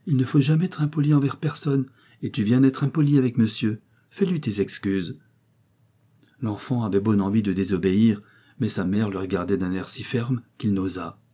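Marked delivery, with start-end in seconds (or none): 0.1-5.2 put-on mother mildly scolding her child; higher voice, settling to usual for the order; gentle reproach. A narrator's reading. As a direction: chide politely but clearly. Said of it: "sur un ton de reproche".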